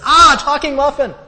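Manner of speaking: very high, loud, laughing shriek of alarm; hammed-up terrified squeal; startled shriek. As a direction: shriek in mock panic at a talking muffin. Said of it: "the other muffin goes".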